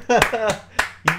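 high, brief chuckle; quick amused giggle; short laugh. Real, not acted. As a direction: laugh lightly at yourself. Laughs after his own line.

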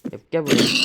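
loud call to a pig. Real, not acted. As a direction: shout across to the pig. Calling the pig again.

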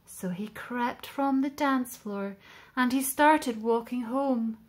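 plain reading voice

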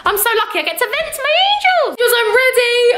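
high, bright gush to viewers; gushing excitement. Real, not acted. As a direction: thank your angels in a happy rush.

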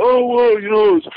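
whiny dodging excuse; mocking the boss. Mocking the boss's excuse when released, high whine.

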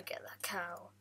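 the same words breathed in a whisper. Acted. Gamer sneaking up on the cow, whispered. Repeat whispered right after.

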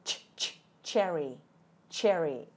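"cherry" led in by separate puffed "Ch, ch"; emphasised initial ch. Shown as a demonstration. Teacher drilling the first sound for viewers, emphasised "ch". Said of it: "âm ch ở đầu nha".

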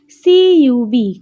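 letters spelt slowly and firmly; slow, emphatic letter-by-letter spelling. Shown as a demonstration. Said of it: "એમાં પણ ત્રણ spelling છે".

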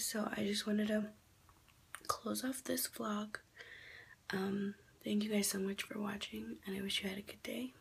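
hushed vlog farewell; whispered sign-off. Real, not acted. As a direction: whisper the outro to the viewers. Closes vlog outdoors whispering.

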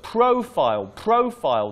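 "profile" twice, PRO stressed strongly; stress on first syllable. Shown as a demonstration. Teacher recording pronunciation feedback, stressing first syllable. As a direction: punch PRO in "profile", then repeat it. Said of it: "The stress is on the first syllable".

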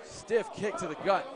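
ordinary talk

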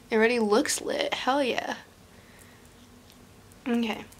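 ordinary talk

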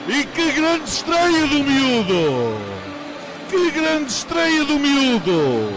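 high, loud goal scream; fast dramatic football commentary. Real, not acted. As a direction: roar the goal call, stretching it. Second goal, commentator erupts.

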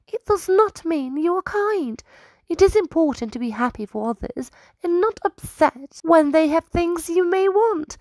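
high squirrel voice, worried and earnest; high, anxious squirrel pleading; concerned. Acted. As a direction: gently warn the proud king. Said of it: "In a voice of concern".

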